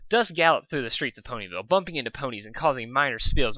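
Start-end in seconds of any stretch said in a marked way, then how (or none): none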